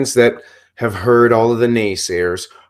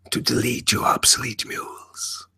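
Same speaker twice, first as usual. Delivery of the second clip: hushed, menacing whisper; 2 semitones lower, 6 dB quieter than usual. Acted. Character's menacing threat.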